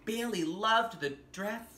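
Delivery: gentle storybook narration with wonder; story reading to children. Acted. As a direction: read it like a bedtime picture book. Reads picture book aloud.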